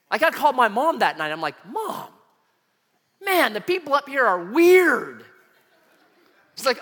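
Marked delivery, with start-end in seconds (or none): 1.7-5.2 high-pitched, drawn-out mock whine; exasperated complaint. Son phoning mom about campus people, exasperated. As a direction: complain high, swelling louder toward the end.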